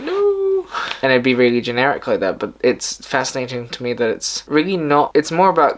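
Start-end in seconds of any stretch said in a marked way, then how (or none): 0.0-0.3 a sudden much higher, hammed-up "No"; mock heroic refusal. Vlogger mocking a generic Superman refusing kryptonite, hammed-up high. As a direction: jump way up for a cartoonish hero's refusal.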